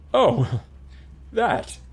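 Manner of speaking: high, breathy nervous chuckle on the words; nervous laugh. A narrator's reading. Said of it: "Hugor laughed with nervous energy".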